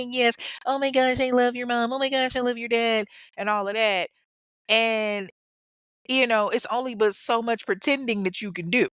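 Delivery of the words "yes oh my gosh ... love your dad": higher, louder mock-sweet gushing; hammed-up fake cheer for the kids; fake cheerful. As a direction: gush like you're faking happiness. Mocking fake smiles for kids.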